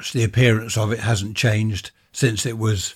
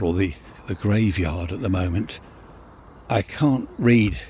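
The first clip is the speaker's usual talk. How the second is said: normal talking voice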